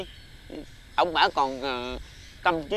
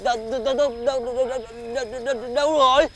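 frantic asking; 5 semitones higher than usual. Acted. Frantic searching for Bảy.

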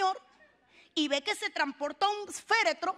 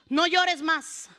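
slow, measured command with falling end; firm pronouncement; 4 dB louder, much slower than usual. Acted. Preacher gives Jesus's answer to the sick, slow firm pronouncement. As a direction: say it slowly and settle downward.

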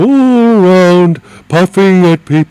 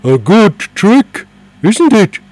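proud; 8 semitones higher, 7 dB louder, slower than usual. Acted. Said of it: "looked proudly at James".

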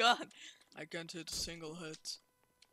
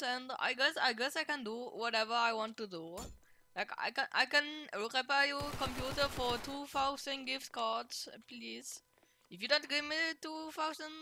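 higher-pitched put-on Indian accent; 5 semitones higher, 4 dB louder than usual. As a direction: play a scam caller with Indian accent. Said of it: "Do you wanna hear my, um, Indian, uh, voice?".

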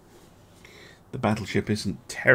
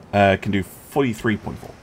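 usual speaking voice

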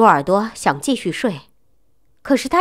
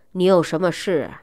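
impatient; 3 semitones lower, 3 dB quieter than usual. Acted. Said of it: "不耐烦地问".

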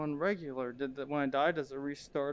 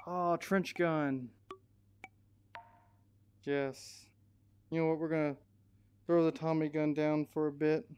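normal talking voice

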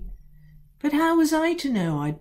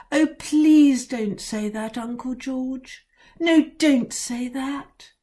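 higher, louder, slow pleading; tearful pleading; 7 semitones higher, 4 dB louder, slower than usual. A narrator's reading. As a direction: beg the uncle, near tears. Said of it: "almost in tears".

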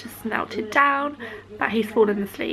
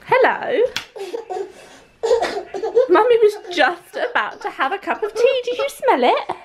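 high, bright sing-song with laughs; lilting high voice for a little one; 10 semitones higher than usual. Real, not acted. Mum greeting her son as he wakes, high and sing-song.

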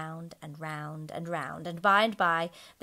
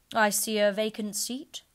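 ghost's grave answer; 3 semitones higher, 6 dB louder than usual. A narrator's reading. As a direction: weigh each word like a prophecy. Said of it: "replied the ghost".

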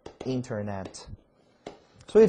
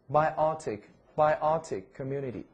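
slow term repeat; 3 semitones higher, 4 dB louder, slower than usual. Shown as a demonstration. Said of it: "teacher repeating term slowly".

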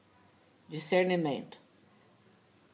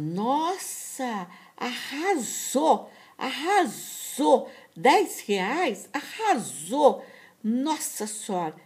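high, slow, louder gushing "arrasou"; camp delighted imitation; 6 semitones higher, 4 dB louder, slower than usual. Man thanking her for ten reais, high gushing delight. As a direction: sing out the thanks like a thrilled drag diva.

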